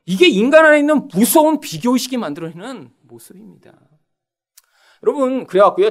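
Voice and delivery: ordinary talk, nothing marked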